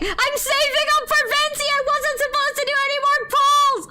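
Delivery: high, loud exasperated wail; frantic outburst. Real, not acted. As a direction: wail it at the screen in joyful despair. Mock-scolding after expensive pull.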